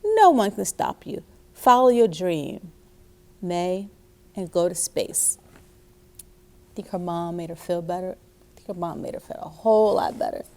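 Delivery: put-on animated, emphatic reading to children; expressive picture-book narration with loud key words; storybook read-aloud. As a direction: read it out big and encouraging for little listeners.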